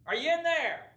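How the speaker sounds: high-pitched calling voice; dad calling out. Retold or imitated. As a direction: imitate a father hollering for his son. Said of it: "I hear my dad's voice".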